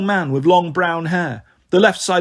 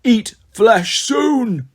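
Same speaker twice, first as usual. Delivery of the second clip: high, slow ghoulish scream; drawn-out hungry ghoul cry; 6 semitones higher, much slower than usual. A narrator's reading. Ghoul kneeling before the king, screaming. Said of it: "knelt before the king and screamed".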